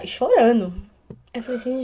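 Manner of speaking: ordinary talk, nothing marked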